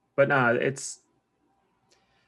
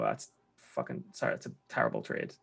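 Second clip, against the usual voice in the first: muttered thought; 12 semitones lower, 8 dB quieter than usual. Retold or imitated. Inner thought about a bad trade.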